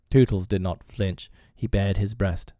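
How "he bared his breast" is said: plain reading voice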